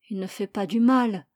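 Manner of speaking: plain reading voice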